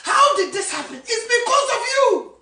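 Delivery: high, loud accusing cry; rising preaching shout. Acted. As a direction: drive it home loudly.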